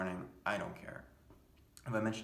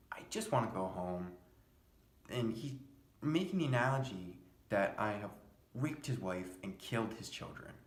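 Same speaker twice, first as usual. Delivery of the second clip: normal talking voice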